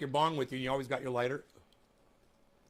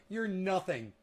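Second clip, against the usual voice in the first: ordinary talk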